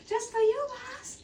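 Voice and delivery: very high, fading coo; cooing at the freshly groomed dog in a very high voice; dog talk. Real, not acted.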